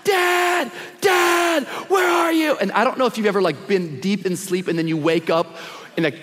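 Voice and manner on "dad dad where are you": put-on high, drawn-out kid wail; mock panicked child's scream; imitating screaming child. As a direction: cry out for Dad like a scared little boy. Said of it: "Wyatt, screaming".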